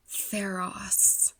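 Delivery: hushed whispered reveal after a pause; dramatic reveal. Retold or imitated. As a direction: whisper the name like a big twist.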